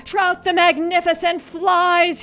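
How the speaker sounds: a fish shouting with joy as he takes off; triumphant shout. Acted. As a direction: crow it out in triumph. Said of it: "he shouted".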